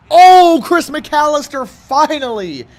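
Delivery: thrilled shout for a long-awaited pick; excited outburst. Real, not acted. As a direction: celebrate it loudly, stretching finally. Finally gets an interception.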